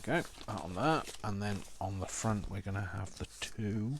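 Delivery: normal talking voice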